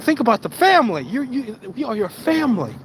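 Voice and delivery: high-pitched, mock-hurt employer; guilt-tripping boss. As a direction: lay on the guilt, hammed-up. Boss guilt-trips worker.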